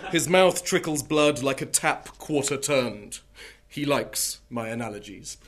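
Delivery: deadpan poetry reading, punchy lines; poem reading. Acted. Poet reading The Murderer to an audience, deadpan. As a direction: read it like a serious poet with comic timing.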